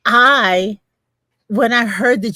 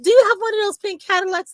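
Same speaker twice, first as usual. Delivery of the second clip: quick, much higher eager question; eager customer; 10 semitones higher, much faster than usual. Retold or imitated. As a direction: rush the question out, thrilled. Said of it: "And she said".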